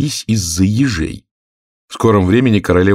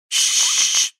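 long voiceless shushing hiss; 3 dB quieter than usual. A narrator's reading. Anxious rabbit hisses at Alice to be quiet. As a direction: hush with a drawn-out sh. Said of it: "зашипел кролик".

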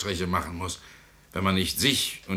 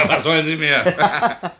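slow, loud laughing jab; 6 semitones higher, 5 dB louder, slower than usual. Acted. Mocking the idealist, laughing.

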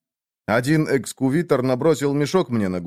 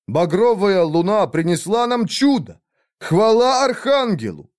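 high, loud, rapturous cry; ecstatic praise; 8 semitones higher, 3 dB louder than usual. Acted. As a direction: cry out in religious awe. Kneeling in awe, praising miracle. (восторженно)

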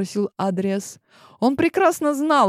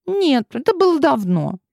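put-on high girl voice, dismissive; princess voice; 9 semitones higher, 4 dB louder than usual. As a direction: brush it off like a princess. Clementine's voice again.

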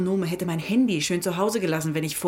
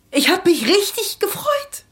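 emotional; 11 semitones higher than usual. Acted. Grieving suspect recalls friendship.

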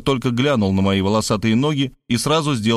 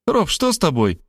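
surprised; 6 semitones higher than usual. A narrator's reading. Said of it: "удивилась она".

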